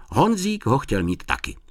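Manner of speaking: plain reading voice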